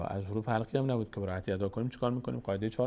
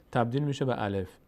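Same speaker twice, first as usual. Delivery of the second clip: usual speaking voice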